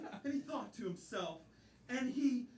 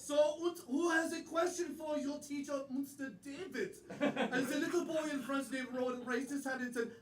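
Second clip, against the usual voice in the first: hammed-up German-accented storyteller. Player telling chapter three about Mister David, German accent. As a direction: tell it with a comic German accent.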